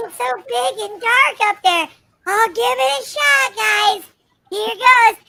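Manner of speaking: mock terror. Avatar dares the gaping hole, mock fear to shout. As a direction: drag it out scared, then yell.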